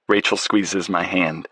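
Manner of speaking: plain reading voice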